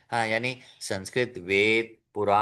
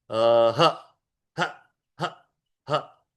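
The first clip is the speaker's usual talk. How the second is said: breath pulses; 3 dB louder, much slower than usual. Shown as a demonstration. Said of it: "प्राणायाम होता है".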